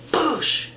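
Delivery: high, punchy "boosh"; punch sound effect. Retold or imitated. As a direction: imitate the impact of a punch. Imitated punch noise.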